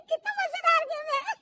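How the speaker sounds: high giggling while watching; daughter-in-law laughing at the TV show; laughing delight. Acted.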